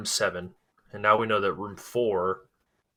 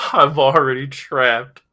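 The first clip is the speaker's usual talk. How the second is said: laughing; 3 semitones higher, 7 dB louder, slower than usual. Retold or imitated.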